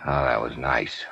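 breathless dying whisper; a faint whisper after the kiss. Acted. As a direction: barely breathe the words out to her.

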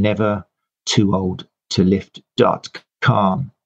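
each word set apart, unhurried; slow, deliberate plug. Real, not acted. Host plugging an ebook website to listeners, slow and deliberate. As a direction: spell out the web address word by word, slowly.

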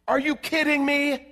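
exasperated disbelief. Real, not acted. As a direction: hit kidding with disbelief. Exasperated rebuttal.

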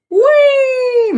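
very high, loud, stretched wee; Mario-style "wee" squeal. Retold or imitated. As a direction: squeal it like a game sound effect. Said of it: "なんか". (甲高く伸ばした「ウィー」)

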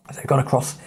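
ordinary delivery

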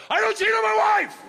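very high, loud defensive yell; public shouted announcement. Acted. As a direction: shout it out for the whole room to hear. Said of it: "in front of everyone and go".

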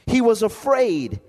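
usual speaking voice

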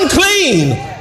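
high shouted unclean; hollered unclean. Shown as a demonstration. Preacher urging parents to holler, shouted cry. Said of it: "you need to holler out".

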